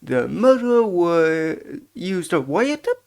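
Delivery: obsequious bellboy. Acted. Bellboy boasting of the hotel's reputation, eager servile voice. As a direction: savour the hotel's coming fame, pitch swooping.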